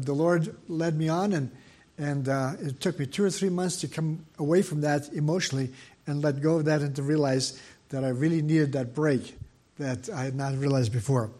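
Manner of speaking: ordinary talk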